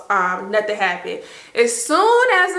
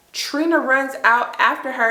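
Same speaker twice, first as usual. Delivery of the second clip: ordinary delivery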